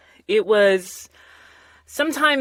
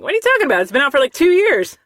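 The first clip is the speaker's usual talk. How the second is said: high, loud disbelief; who hasn't heard this yet; incredulous; 8 semitones higher, 6 dB louder, much faster than usual. Retold or imitated. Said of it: "I'm like".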